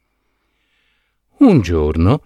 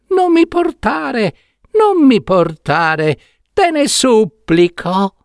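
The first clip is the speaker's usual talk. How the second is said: tiny voice, much higher, begging; thin little pleading voice; 12 semitones higher than usual. Acted. Trapped prince begging Saetta not to deliver him, tiny thin pleading voice. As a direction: plead from inside the box, small and thin. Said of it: "la vocina tornava a insistere".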